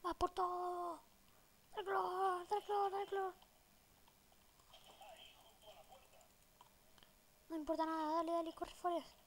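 high, loud frantic urging; excited shouting. Real, not acted. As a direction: yell it mid-firefight. Hektisches Kampfgefecht im Spiel.

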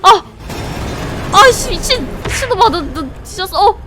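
high loud startled yelling. Real, not acted. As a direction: yell in sudden fright. Monster appears again.

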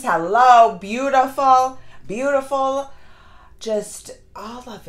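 loud, emphatic praise; gushing over costumes. Real, not acted. Vlogger raving about the costumes, loud and emphatic.

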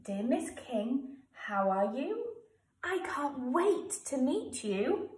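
slow read-through of the letter; reading back slowly. Shown as a demonstration. Teacher reading the finished letter to pupils, slow.

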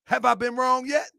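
high pitch, moderate volume, rising end; high-pitched rhetorical question. Real, not acted. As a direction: ask it pointedly without shouting. Repeats the challenge less forcefully.

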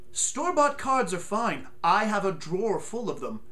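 usual speaking voice

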